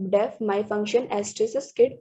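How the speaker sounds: ordinary delivery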